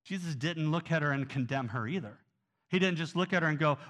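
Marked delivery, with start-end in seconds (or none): none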